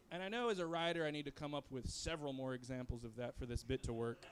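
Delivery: ordinary talk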